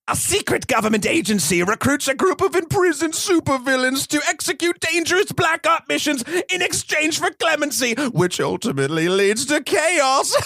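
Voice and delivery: high-pitched theatrical cartoon supervillain; Joker voice. Podcaster reading a movie synopsis to co-host as a cackling villain, Joker voice. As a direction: ham up a villain's synopsis, relishing it into chaos. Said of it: "I'm gonna do it in my Joker voice".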